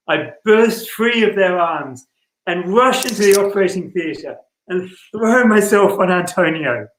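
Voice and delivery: usual speaking voice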